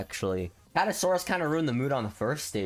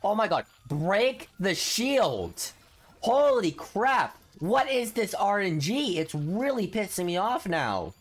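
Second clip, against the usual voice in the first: frustrated outburst; 6 semitones higher, slower than usual. Real, not acted. Gamer yells at units that cannot break the shield, exasperated. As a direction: stretch it out, fed up.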